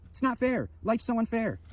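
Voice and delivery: high-pitched mocking whine; mock crybaby. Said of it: "you just cry like a bitch".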